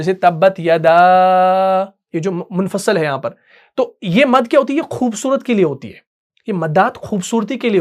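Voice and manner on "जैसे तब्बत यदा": तब्बत यदा with the vowels drawn out, very slow; elongated madd. Shown as a demonstration. Teacher shows the madd to the class, drawn out very slowly. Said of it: "ये जो मद होती है".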